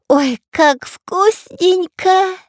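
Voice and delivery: very slow, high gluttonous wail; wailing with relish. A narrator's reading. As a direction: draw it out high, savouring each word. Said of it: "причитал Пулькин".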